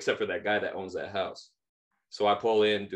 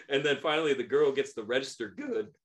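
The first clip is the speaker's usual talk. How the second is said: ordinary talk, nothing marked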